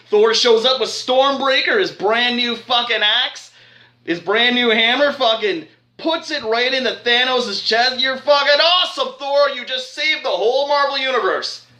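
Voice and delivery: high-pitched, thrilled cheering; fan hype. Real, not acted. Fan cheering Thor for saving the universe, hyped. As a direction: gush at the hero like a pumped-up fan.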